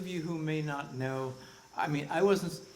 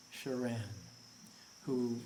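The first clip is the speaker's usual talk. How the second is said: ordinary talk, nothing marked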